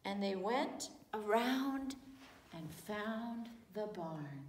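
ordinary delivery

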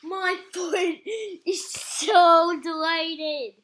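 loud, slow, laughing delight. Real, not acted. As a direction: revel in the relief, laughing. Foot freed from tight socks.

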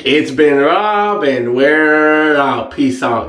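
long, drawn-out words; signature sign-off. Real, not acted. Vlogger ending his video for viewers, drawn-out sign-off. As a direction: stretch the catchphrase slowly.

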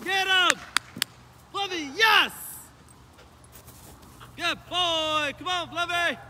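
high calls from a distance; far off commands. Real, not acted. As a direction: call the dog from far off.

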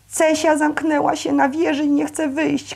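high-pitched calling without much volume; crying out. A narrator's reading. Girl bursting into the room with news, crying out. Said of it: "krzyknęła".

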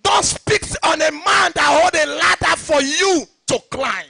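loud, high, driving pulpit shout; fiery sermon cadence, ringing out; preaching at full force. Acted. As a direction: hammer each line home to the church. Pastor preaching to congregation.

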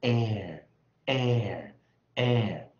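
"air" held slow and loud, gliding into an r; drawn-out R-colored vowel. Shown as a demonstration. Teacher models E plus R for students, drawn-out R-colored vowel. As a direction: stretch each "air" loudly, falling into the r. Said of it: "我们就念".

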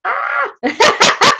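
high squeal of mock shock; shocked shriek. Real, not acted. Reacting to her husband's cheeky line, high mock shriek. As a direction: squeal as if scandalised.

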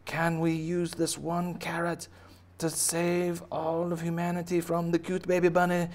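high, slow, theatrical appeal; melodramatic plea. Acted. Humanity hoping one carrot saves it, high plea. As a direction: plead grandly in a high voice. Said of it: "And it's like".

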